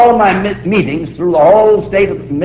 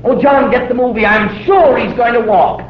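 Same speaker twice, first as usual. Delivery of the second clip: higher, eager mother's voice; excited mother; 7 semitones higher than usual. Acted. Mother urging husband to film baby, excited. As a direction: gush like a proud mom. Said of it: "Mother says".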